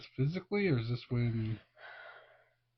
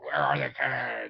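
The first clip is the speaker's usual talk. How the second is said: deep, slow villain voice; Vecna; 9 semitones lower, 4 dB louder, slower than usual. Retold or imitated. Adult mocking Vecna searching for the kids, deep slow villain voice. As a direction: demand it in a drawn-out low monster voice. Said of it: "As Vecna was like".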